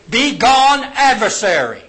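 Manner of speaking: loud, high command with GONE stretched; ringing, punched dismissal; emphatic rebuke. Acted. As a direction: cast out the adversary forcefully. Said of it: "it's more emphatic".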